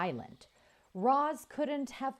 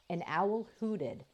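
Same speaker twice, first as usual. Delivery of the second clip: ordinary delivery, nothing marked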